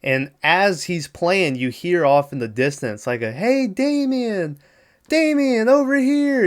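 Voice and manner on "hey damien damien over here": high, slow, sing-song calling; eerie distant call. Retold or imitated. Nanny calling to Damien from a distance, high and eerie. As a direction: lure the child from far away.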